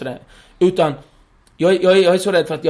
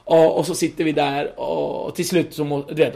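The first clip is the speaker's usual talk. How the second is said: ordinary delivery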